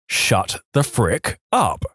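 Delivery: very slow, a pause after each word. Acted. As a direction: land each word separately, like four typed words. Reads out four typed words.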